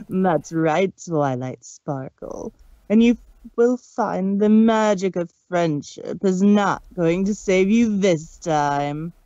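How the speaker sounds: loom over her, savoring it; villain voice. Acted. Discord gloating down at terrified Twilight, menacing. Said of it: "in a voice that wasn't hers".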